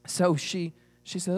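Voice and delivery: plain reading voice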